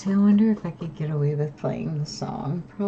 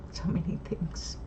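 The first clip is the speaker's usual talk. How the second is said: whisper; 8 dB quieter than usual. Real, not acted. Wistful trailing-off wish.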